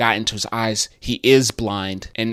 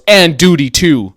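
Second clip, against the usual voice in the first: louder, firm "And duty, too"; mock stern retort; 5 semitones higher, 9 dB louder than usual. Lady answering the rider, a louder mock stern retort. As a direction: punch it back like a vow.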